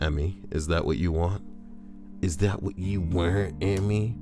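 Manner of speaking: usual speaking voice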